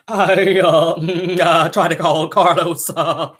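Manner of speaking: slow, quavering bleat of a sob; mock crying with a goat's wobble; billy-goat crying. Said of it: "still sound like a billy goat when you cry".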